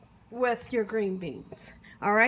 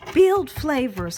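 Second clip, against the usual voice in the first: louder and slow, leaning on "Build flavors"; emphatic urging; 7 dB louder, slower than usual. Real, not acted. Cook urging home viewers to build flavors, slow and emphatic.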